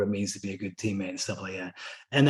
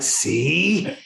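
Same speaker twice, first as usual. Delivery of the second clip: mock-triumphant, higher and drawn out; told-you-so; 4 semitones higher than usual. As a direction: rub it in playfully with a rising voice. Dad gloating at son's admission.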